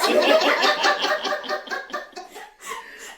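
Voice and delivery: long high laugh. Real, not acted. Laughing at pidgin attempt.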